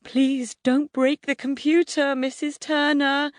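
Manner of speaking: put-on high, anxious boy's voice; trembling plea. A narrator's reading. Neighbour boy begging the mum, frightened. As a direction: beg nervously in a high voice.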